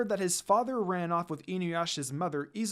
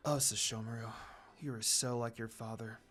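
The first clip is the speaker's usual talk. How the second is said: lower, softer musing; mother thinking of her son, softly to herself; inner thought; 7 semitones lower, 8 dB quieter than usual. Acted. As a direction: say it to yourself, wistful. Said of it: "she thought".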